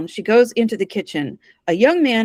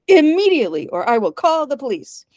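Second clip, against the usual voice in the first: acted stern insistence; indignant ultimatum; 6 semitones higher than usual. A narrator's reading. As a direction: threaten the lodger, striking "Immediately" high. Ordering lodger out, repeated word.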